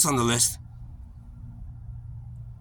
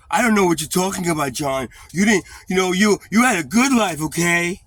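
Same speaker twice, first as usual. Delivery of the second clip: louder, higher, dismissive scolding; family member scolding; 7 semitones higher, 8 dB louder than usual. Retold or imitated. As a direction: brush him off loudly. Voicing family scolding John.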